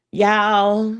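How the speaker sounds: ordinary delivery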